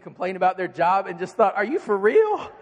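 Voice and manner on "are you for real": incredulous. Retold or imitated. Incredulous thought at complainer.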